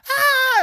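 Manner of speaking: a sudden, very high, loud "Ah!"; mock child scream. Preacher mimicking a child's scream, high and loud. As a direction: yelp like a kid feeling the belt. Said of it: "They start screaming".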